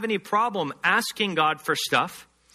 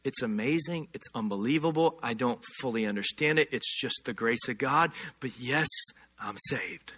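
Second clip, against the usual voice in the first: humbled awe; 8 semitones lower, 6 dB quieter than usual. Retold or imitated. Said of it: "the response is".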